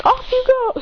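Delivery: high-pitched character call; Ash. Acted. Boy dispatching the Ultra Guardians, high-pitched call. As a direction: send them off in a high voice. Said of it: "Ash says".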